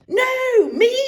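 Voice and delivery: very high loud cry; shrieking. A narrator's reading. Martha cries to be chosen instead. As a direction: cry out over him. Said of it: "cried Martha".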